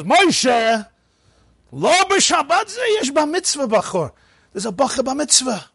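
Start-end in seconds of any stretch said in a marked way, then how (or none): 0.0-4.1 high, urgent voice of the synagogue gabbai. Retold or imitated. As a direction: break the news to the old man, pitched high. Gabbai informs old reader.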